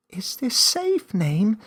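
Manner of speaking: put-on young Millie eagerly asking the creature; eager little girl. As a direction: bounce with curiosity like a young child. Millie asks.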